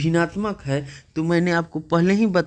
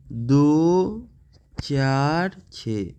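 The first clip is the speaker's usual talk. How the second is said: very slow, drawn-out digits with gaps; digit-by-digit reading; much slower than usual. Shown as a demonstration. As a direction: read दो, चार, छः one by one, stretching each. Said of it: "इस तरह से पढ़ते हैं".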